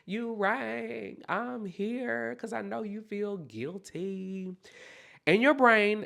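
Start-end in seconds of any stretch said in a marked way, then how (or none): 0.0-4.5 high, soft, drawn-out voice; softly sing-out a slow, high greeting; grief personified. Acted. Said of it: "It's gonna be like".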